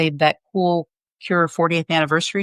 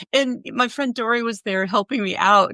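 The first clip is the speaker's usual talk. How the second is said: usual speaking voice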